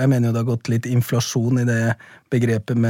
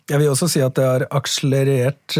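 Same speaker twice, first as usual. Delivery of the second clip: ordinary talk, nothing marked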